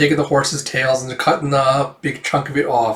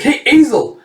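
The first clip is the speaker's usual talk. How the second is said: high, raised call to a comrade; 11 semitones higher, 5 dB louder than usual. Acted. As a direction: get his attention across a fight. Comrade calling out in battle.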